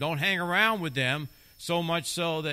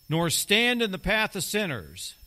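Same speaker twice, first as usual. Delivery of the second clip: higher, louder scripture reading; scripture proclaimed; 6 semitones higher, 6 dB louder than usual. Acted. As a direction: proclaim the verse to the church.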